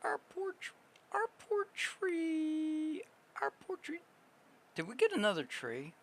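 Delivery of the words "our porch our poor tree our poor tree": sad. Real, not acted. Pity for a tree.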